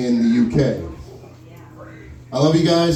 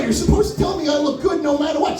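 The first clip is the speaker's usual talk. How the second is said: mock outrage; 13 semitones higher than usual. Retold indignant reply to mom.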